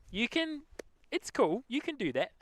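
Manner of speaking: high-pitched, mock-polite reassurance; joking, high "be my guest" tone; mock-accommodating reply. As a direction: let them off in a high little voice. Acted reply to accent mockers.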